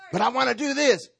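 put-on high, loud childish whine; a grown man whining like a kid; whiny protest. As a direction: protest like a child who wants their way.

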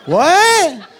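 put-on loud, high-pitched kid's "what"; hammed-up baffled child yell; clueless kid. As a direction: blurt it like a child who can't understand. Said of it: "kids, we, we do that".

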